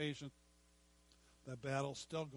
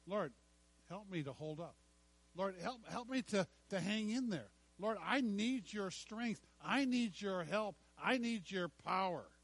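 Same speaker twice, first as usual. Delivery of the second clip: pleading prayer; 4 semitones higher than usual. Acted. Acted prayer for strength.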